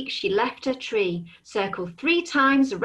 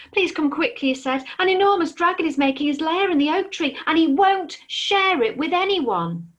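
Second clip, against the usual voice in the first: higher, fast and breathless plea; urgent squirrel; 7 semitones higher, 3 dB louder than usual. Acted. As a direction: rush it out in panic. Squirrel begging owl for help.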